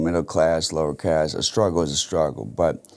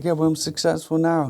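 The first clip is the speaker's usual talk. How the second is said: much higher, cocky comeback; proud retort; 11 semitones higher than usual. Retold or imitated. Answering the critics about school, high cocky retort. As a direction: toss it back with playful pride. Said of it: "It's like".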